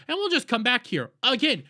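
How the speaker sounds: ordinary talk, nothing marked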